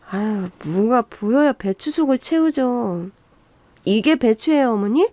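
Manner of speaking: plain reading voice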